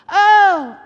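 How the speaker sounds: loud, high, happy "oh"; hammed-up burst of contented relief; acted happy relief. Preacher as Abraham finally at peace with his son, happy relief. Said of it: "And he's so happy".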